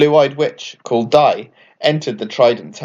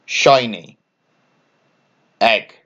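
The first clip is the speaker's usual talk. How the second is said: usual speaking voice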